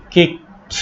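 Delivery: "কিকড" said as "kikt"; final -ed as T. Shown as a demonstration. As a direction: close "কিকড" on a voiceless t. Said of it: "ট উচ্চারণ করতে হবে". ("কিকড"-এর শেষে ট উচ্চারণ)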